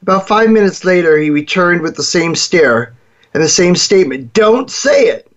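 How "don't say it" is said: high, slow, insistent warning; mock angry warning. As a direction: slowly warn her, pitched up and tense. Boy warning his sister again.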